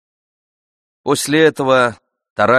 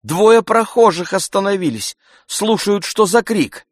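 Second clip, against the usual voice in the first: high-pitched woman's voice; landlady's protest; 10 semitones higher than usual. A narrator's reading. Woman characters voiced by narrator.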